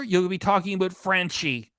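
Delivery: ordinary talk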